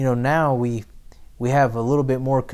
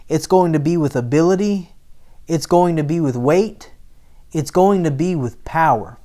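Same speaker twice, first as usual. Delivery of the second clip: full-force preaching; 2 semitones higher than usual. Acted. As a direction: rouse the church and build to a shout.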